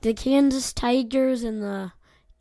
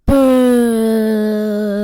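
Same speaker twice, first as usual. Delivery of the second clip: booing; 10 dB louder than usual. Real, not acted. Booing partner's pick.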